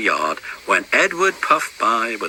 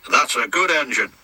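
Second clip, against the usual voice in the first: ordinary delivery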